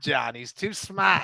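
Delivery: "smart" said "smaht", r dropped Boston style; a touch of Boston on "smart"; Boston accent. Acted. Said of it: "I said smart".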